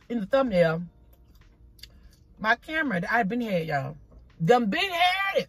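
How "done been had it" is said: talking with a mouth full of food; words around a bite, mouth full. Real, not acted. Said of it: "for talking with my mouth full".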